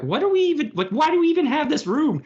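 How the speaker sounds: high, mock-baffled voice; baffled crew member. Crew puzzling over a flower room, high and baffled. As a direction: squeak out the confusion.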